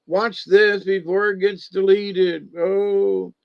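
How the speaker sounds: mock alarm. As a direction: moan in make-believe dread. Continues mimicking doom and gloom.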